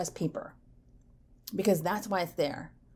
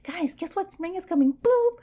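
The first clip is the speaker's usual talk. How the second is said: much higher, bubbly and thrilled; excited tree; 11 semitones higher, 3 dB louder than usual. Pretend. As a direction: burst out like a tree that can't wait for spring. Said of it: "they're probably like".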